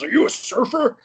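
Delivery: high, loud mock-astonished question; incredulous director. Director quizzing a young actor about surfing, incredulous. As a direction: imitate an astonished director, pitch leaping up.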